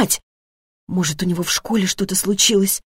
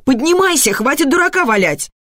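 high-pitched impatient order; exasperated mother's command; cross scolding; 6 semitones higher than usual. Acted. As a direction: scold a child out of bed. Acted mother scolding son out of bed.

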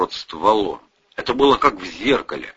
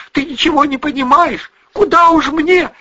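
high, loud quarrelling; heated exchange; 6 dB louder than usual. A narrator's reading. Mother and daughter trade retorts, heated. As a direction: throw it back angrily, pitch way up.

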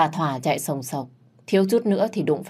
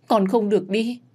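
pleading wail; 5 semitones higher than usual. Acted. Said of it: "gào lên đau đớn".

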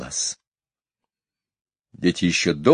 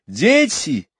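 high, loud upset call; mother's high, anxious calling; 4 dB louder, much slower than usual. A narrator's reading. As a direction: call the children from the doorway. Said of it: "кричала миссис Банкс".

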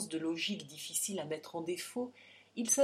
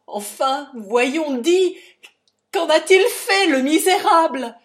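crié très fort et haut, désespéré; hurlement de rage; 9 semitones higher, 19 dB louder than usual. A narrator's reading. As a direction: hurle contre la destinée. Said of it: "il hurla". (père révolté hurle sa rage, voix très forte)

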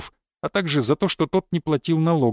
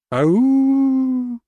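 high howling wolf cry; 9 semitones higher, 4 dB louder than usual. Acted. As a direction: howl like a wolf, loud and high. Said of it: "Следователь завыл, как волк".

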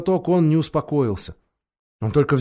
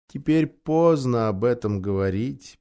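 sad; 5 semitones lower, 5 dB quieter than usual. A narrator's reading. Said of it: "грустно покачивая головой".

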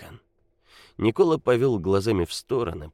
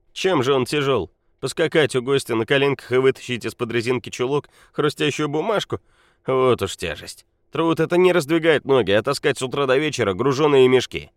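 put-on drunk scoffing man; 7 semitones higher, 7 dB louder than usual. A narrator's reading. Drunken Nikola scoffs loudly.

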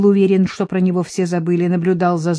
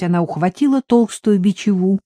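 ordinary delivery

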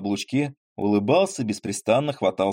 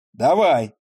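demanding shout; 4 semitones higher, 6 dB louder than usual. Acted. Grabbing for reins.

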